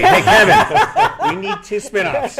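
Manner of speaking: high, loud and slow, laughing; playful call to the boss asking for spinoffs; mock call. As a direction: shout a cheeky request into the phone. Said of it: "call Kevin Feige".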